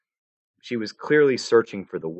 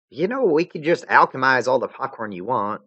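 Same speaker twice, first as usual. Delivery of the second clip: put-on bright helpful girl voice; Jade voice; 6 semitones higher, 4 dB louder than usual. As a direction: practical young girl.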